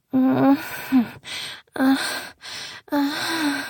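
drawn-out sighing moans; breathy moans. Acted. Performing desire for the listener, drawn-out moans. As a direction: let out moans with pauses between. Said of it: "When you hear me moan".

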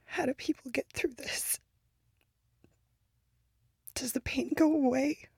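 high, hushed and hurting; despairing whisper; sad whisper. Acted. Quiet grief after the outburst.

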